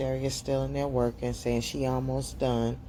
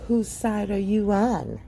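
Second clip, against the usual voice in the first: higher, slow, put-on accusing mother voice; much higher, slow mock mother; suspicious mother; 11 semitones higher, 3 dB louder, slower than usual. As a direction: draw it out like a distrustful mom. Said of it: "And she was like".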